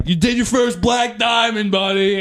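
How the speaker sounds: mock proud friends. Friends congratulate him.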